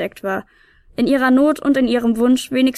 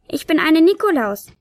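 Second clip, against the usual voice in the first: higher, cheeky and grinning; cheeky grin; 6 semitones higher, 3 dB louder than usual. Acted. Tiny helper cheekily introduces herself to the piper, grinning. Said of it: "frech grinend".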